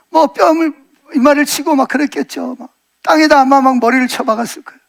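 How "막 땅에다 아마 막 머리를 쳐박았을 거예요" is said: ordinary talk, nothing marked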